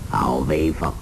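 low, drawn-out mock admiration; amused taunt. A narrator's reading. Hong Qigong taunting Ouyang Feng after laughing, slow amused mockery. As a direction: taunt slowly, starting low in pitch. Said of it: "洪七公睁眼笑道".

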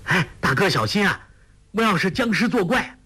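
calling out in alarm. A narrator's reading. 杨康 warns his sworn brother away, alarmed call. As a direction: warn him off the coffin, spooked. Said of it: "杨康叫道".

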